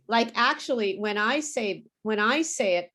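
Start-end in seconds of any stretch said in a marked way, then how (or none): none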